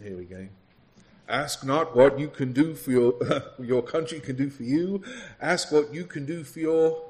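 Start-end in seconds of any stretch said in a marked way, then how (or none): 1.3-7.0 loud speech-making in a put-on American presidential accent; Kennedy accent. Preacher quizzing congregation on famous speeches, loud imitation American accent. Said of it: "the accent".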